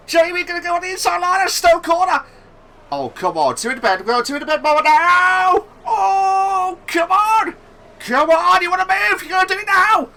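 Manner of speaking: high, loud excited commentary yell; yelling at a horse race. Real, not acted. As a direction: scream the overtake like a finish line. Battle for position, live commentary.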